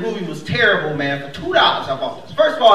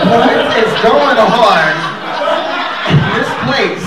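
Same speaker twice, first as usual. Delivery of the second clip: mock outburst; 3 semitones higher, 7 dB louder, slower than usual. Retold bootleg voice shouting.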